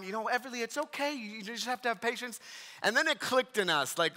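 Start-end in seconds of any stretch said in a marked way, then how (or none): 0.0-2.4 higher, softer soothing voice; motherly. Retold or imitated. Wife soothing the upset daughter in the van, motherly. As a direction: gently imitate a patient mother. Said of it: "trying to be the motherly one".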